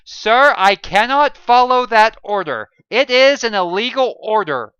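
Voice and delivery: high, loud firm refusal; defiant refusal. A narrator's reading. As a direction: refuse the order to his face. John refusing the order.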